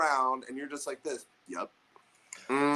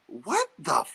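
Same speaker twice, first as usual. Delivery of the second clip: disbelief; 5 semitones higher, 5 dB louder, slower than usual. Retold or imitated. Reacting to coach's rule.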